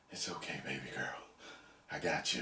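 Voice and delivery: very soft, low imitated reassurance; tender comfort. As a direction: comfort a child in a hushed low voice. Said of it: "he says something like".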